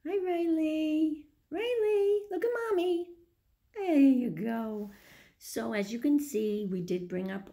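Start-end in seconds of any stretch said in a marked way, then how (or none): 0.1-4.9 high, sing-song cooing to a little one. Real, not acted. Mother calling her baby to look at the camera, high cooing. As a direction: coo up high to get her attention.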